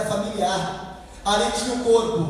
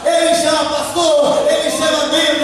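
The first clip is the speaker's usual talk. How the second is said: loud, high, chanting list; full-force preaching; 5 semitones higher, 7 dB louder than usual. Real, not acted. Preacher telling everyone Jesus calls them, shouting. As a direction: hammer each phrase out in rising fervour.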